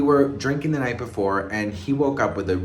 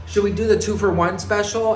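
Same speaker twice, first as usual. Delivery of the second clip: quoting him; 9 semitones higher, 3 dB louder than usual. Retold or imitated. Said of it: "he's like".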